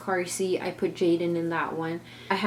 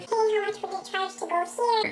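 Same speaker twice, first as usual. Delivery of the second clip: much higher put-on mom voice; mimicking mom. Narrator imitates her mom asking the driver, high-pitched.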